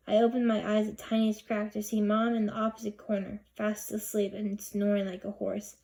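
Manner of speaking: ordinary delivery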